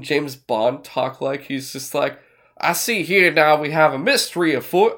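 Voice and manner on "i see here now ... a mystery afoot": a light blustery Southern rooster voice; Foghorn Leghorn drawl. Retold or imitated. Friend imitating Daniel Craig's detective to a podcast cohost, hammed-up Southern drawl. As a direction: ham it up like a cartoon rooster colonel. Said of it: "he just talks like Foghorn Leghorn".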